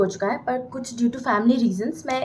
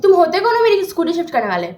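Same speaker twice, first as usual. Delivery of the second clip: louder, higher play-acted challenge; loud confrontation; 5 semitones higher, 5 dB louder than usual. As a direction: confront the scooter mover, hammed-up. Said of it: "मैं इतना ज़ोर से बोल रही हूँ".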